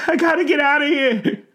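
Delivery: very high, fast, loud wail; frantic tearful old man; desperate panic. A narrator's reading. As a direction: cry it out as you flee. Old man staggering away in panic.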